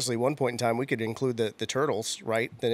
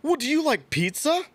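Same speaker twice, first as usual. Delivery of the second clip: put-on very high little-girl voice; Margot. Six-year-old daughter quizzing her Ninja Turtle hero, high-pitched and amazed. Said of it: "And Margot goes".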